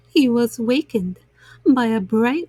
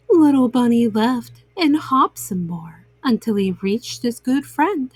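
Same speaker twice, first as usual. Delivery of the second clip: ordinary delivery, nothing marked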